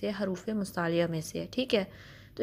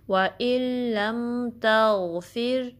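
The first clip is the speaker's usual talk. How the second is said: slow, chanted with a steady meem; slow tajweed recitation; recited steadily; 3 semitones higher, much slower than usual. Shown as a demonstration. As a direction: hold the meem still and recite slowly. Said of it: "मीम को stabilise करके पढ़ना है".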